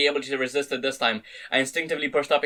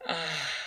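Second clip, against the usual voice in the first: spent sigh; 10 dB quieter than usual. A narrator's reading. Exhausted after climax.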